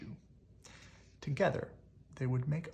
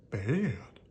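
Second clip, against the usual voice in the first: plain reading voice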